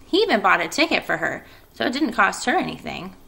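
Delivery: ordinary delivery, nothing marked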